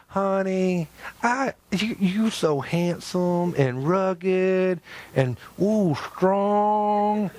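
mock sweet wife. Pastor imitates his wife flattering him, mock sweet coo. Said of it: "she started going".

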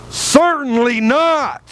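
emphatic, not calm. Shown as a demonstration. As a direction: cry it out with real conviction, slowly. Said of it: "you guys said that too calmly".

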